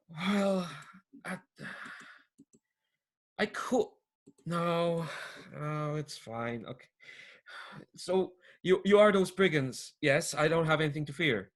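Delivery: deflated. Acted. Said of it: "his shoulders kinda slump, and he says".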